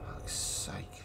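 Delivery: breathy whisper under the breath; whispered curse. Real, not acted. Player cursing to himself, whispered. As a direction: hiss it to yourself, barely voiced.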